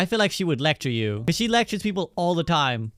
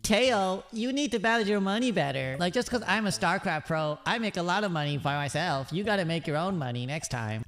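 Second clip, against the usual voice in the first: high, soft, bratty scolding; lightly mocking bratty lecture; 4 semitones higher, 4 dB quieter than usual. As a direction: talk down to him like a spoiled pro gamer. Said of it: "but she'd be like".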